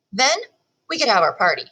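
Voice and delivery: ordinary delivery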